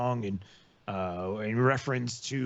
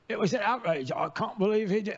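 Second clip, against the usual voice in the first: outraged, higher-pitched fake British accent; bad British accent; 8 semitones higher than usual. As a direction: attempt an indignant British accent, badly faked. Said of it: "I can't do a British accent".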